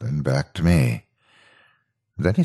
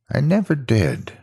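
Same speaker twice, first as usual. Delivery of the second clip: tearful repeat; 10 semitones higher than usual. A narrator's reading. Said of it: "I repeat".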